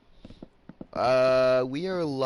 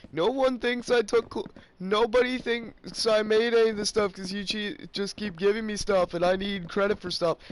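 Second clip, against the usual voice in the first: higher, mocking whine; mocking whiny friend; 8 semitones higher than usual. Vlogger mocking Weston's credit demands, high mock whine. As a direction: mimic his complaining in a higher voice. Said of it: "'cause he's like".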